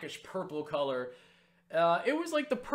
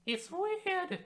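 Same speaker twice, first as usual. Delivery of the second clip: slow, strained falsetto squeak; very high voice; 4 dB louder, much slower than usual. Real, not acted. Speaker laughs at failing to reach the high Cockney pitch, falsetto strain. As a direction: stretch up into a falsetto you can't quite hit. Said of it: "Why do I keep trying to go up there".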